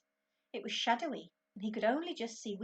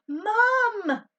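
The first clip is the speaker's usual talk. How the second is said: high, loud desperate shout; boy yelling for mum as the dog leaps; 14 semitones higher, 16 dB louder than usual. A narrator's reading. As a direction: scream for mum. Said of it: "Ben shouted".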